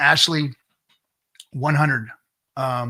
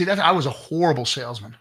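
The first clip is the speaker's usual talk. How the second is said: usual speaking voice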